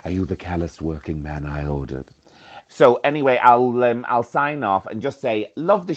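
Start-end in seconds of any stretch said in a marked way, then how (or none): 0.0-2.1 lower, softer seductive purr of a film diva; sultry diva. Pretend. Caller playing a diva greeting the working man, lowered and soft. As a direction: vamp it up like Liz Taylor answering the door. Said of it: "looking like Liz Taylor".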